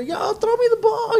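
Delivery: high-pitched imitation of begging fans; mimicked high call-out; calling for the ball.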